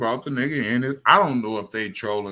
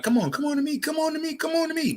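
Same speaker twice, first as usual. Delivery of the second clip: much higher mocking sing-song taunt; mocking the caller; faster than usual. Host mimicking a caller who keeps taunting him, mocking. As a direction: ridicule him with a high squeaky voice. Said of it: "you keep throwing your hands up like".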